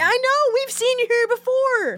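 high-pitched sneering clerk; high mocking staff voice. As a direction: squeak it out smugly.

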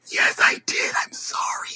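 tiny whispered apology, fading away; mock-guilty whisper, very soft; sheepish whispered confession. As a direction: whisper it as if caught out. Acting out a sheepish self-confession.

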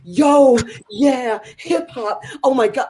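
hammed-up instructor hype; imitating an over-the-top fitness instructor; mock hype instructor. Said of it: "she was very like".

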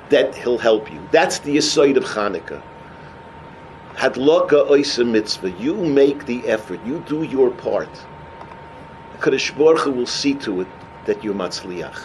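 low, softened earnest teaching; quiet assurance. Real, not acted. Preacher to listeners on Chanukah's lesson, quiet earnest assurance.

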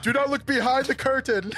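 laughing warning. Acted.

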